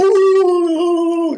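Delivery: high, loud drawn-out ooh, mocking the shouting man; imitated yell. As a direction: bellow it like someone yelling from across a lot. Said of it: "yelling and off the top of his lungs".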